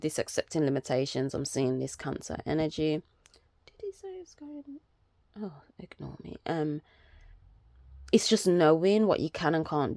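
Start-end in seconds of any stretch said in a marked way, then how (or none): 3.7-6.4 hushed aside off camera; whispering. Real, not acted. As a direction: whisper it under your breath.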